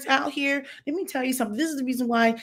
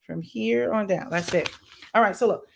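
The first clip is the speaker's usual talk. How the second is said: ordinary talk, nothing marked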